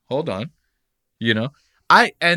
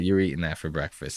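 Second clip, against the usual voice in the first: ordinary talk, nothing marked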